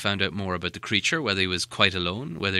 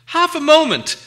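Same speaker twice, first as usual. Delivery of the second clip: much higher, louder cry; Bilbo cries out; 11 dB louder than usual. Acted. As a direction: call out to stop him. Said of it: "cried Bilbo".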